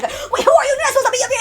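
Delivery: high, loud, startled challenge; mock alarmed guards. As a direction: squawk like guards catching an intruder. Guards discover him, acted out.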